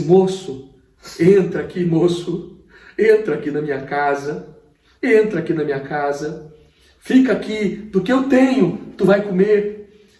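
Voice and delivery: lightly voiced tearful pastor, high and loud; tearful plea. Pastor with tears in his eyes welcomes the homeless man, mild tearful plea. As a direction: plead with him, moved to tears. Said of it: "ele encheu seus olhos de lágrima".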